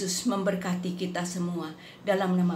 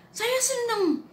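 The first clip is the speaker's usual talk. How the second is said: much higher, thin voice of a sick man; frail man's answer; slower than usual. Retold or imitated. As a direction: answer like a weak dying man. Dying friend answers her.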